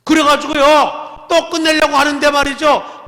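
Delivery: high, loud, slowed emphatic preaching. Real, not acted. Preacher rousing the congregation with a loud retelling. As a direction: push it out to the whole hall.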